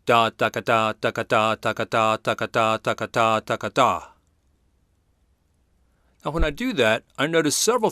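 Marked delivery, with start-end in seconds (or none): 0.1-4.0 sung trumpet syllables da da ka; vocal trumpet phrasing in syllables; trumpet imitation. Shown as a demonstration. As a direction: scat the figure like a trumpet. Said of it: "Let me imitate a trumpet".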